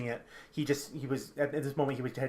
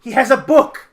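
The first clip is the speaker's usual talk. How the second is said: high, loud blurt; urgent outburst; 11 semitones higher, 16 dB louder than usual. Acted. Captive revealing the villain's book, urgent outburst. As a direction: shout the secret out urgently.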